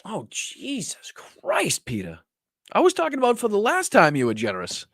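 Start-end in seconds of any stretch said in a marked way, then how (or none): 0.1-2.2 whispered, slow and drawn out; exasperated whisper. Real, not acted. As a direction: breathe it out in disbelief. Exasperated at Peter's generosity.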